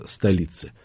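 plain reading voice

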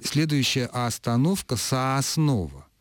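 first vowel of "Сооснова" stretched into a long open A; non-standard drawn-out akanye. Shown as a demonstration. Linguist showing a faulty announcement by young speakers, overdone akanye. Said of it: "это уже нарушение".

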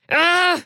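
sudden high yelp of pain; a short shout as if bitten; pained cry. A narrator's reading. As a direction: Bob bitten, crying out. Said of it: "вырвалось у мужчины".

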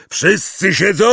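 high, loud call across water; shouted. A narrator's reading. Hagrid shouting from his boat to the others, loud call. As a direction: check on everyone at a shout. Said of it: "krzyknął Hagrid ze swojej łódki".

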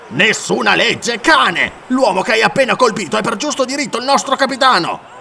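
angry shouted defiance; snarling. Acted. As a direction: spit the insult and claim the right. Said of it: "ringhiò il brituniano".

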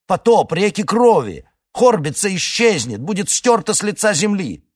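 rising frantic high-pitched shouting; panicked ranting. Acted. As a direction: rant in mounting terror. Elder panics about pogrom.

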